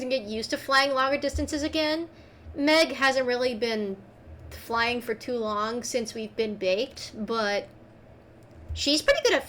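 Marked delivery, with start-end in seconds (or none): none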